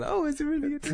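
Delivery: teasing a friend's excited question; mock excited. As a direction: imitate an eager fan, way up high. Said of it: "You were, like, excited then".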